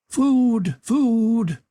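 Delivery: shrill, high-pitched scream of a starving madman. A narrator's reading. Maniac crawling toward his bound victim, screaming. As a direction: shriek it far up in a thin wail. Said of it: "it screamed".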